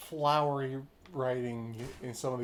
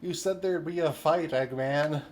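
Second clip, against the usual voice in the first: joking shout; 3 semitones higher, 10 dB louder than usual. Real, not acted. Calling out a friend mid-game.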